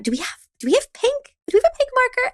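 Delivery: put-on high, bright-eyed question to a child; eager coaxing. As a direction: sell the pink marker like treasure.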